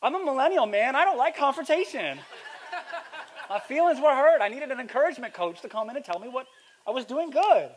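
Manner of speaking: high, loud comic protest; hammed-up hurt millennial; mock wounded complaint. Preacher joking about fearing confrontation, mock wounded protest.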